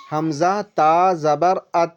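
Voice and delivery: slow spelled-out recitation of حمزہ تا زبر ات; tajweed demo. Shown as a demonstration. Teacher spells ات slowly for a student. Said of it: "جیسے".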